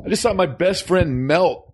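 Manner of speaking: put-on high, aggrieved kid voice; upset boy John. John telling mom about his lost friend, upset kid. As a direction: complain like a wounded child. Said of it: "you can be John".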